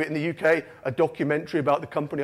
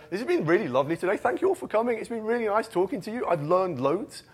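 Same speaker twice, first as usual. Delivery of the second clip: mock-gushing thanks, pitch climbing higher; upbeat gushing; 4 semitones higher than usual. Closing speaker thanks the audience and speakers, upbeat and gushing. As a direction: build into an over-sweet, high-pitched thank-you. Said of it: "that kind of upbeat stuff".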